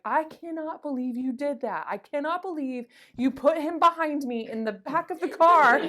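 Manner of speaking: indignant retold rant, pitched well up; mad mom. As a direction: imitate an outraged mother telling off her kid. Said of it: "mad at me. She's like".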